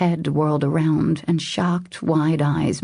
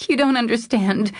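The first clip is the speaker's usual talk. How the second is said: high, desperate plea; 8 semitones higher than usual. A narrator's reading. Woman pleading with him to understand, desperate. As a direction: plead desperately, pitch raised well up. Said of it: "she said desperately".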